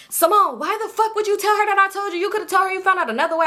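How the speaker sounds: high-pitched mock indignation; indignant cousin. Cousin on the phone demanding why she told, high mock outrage. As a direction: imitate an upset caller, high and fast.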